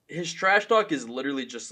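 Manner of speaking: normal talking voice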